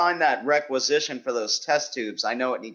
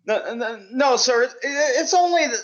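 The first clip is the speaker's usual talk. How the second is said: flustered; 10 semitones higher than usual. Acted. Flustered stammering denial.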